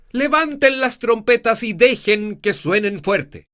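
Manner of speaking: loud, high trumpet call; booming trumpet proclamation. Shown as a demonstration. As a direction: proclaim it with full force. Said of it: "yo podría decir".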